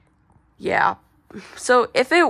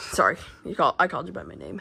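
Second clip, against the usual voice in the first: ordinary talk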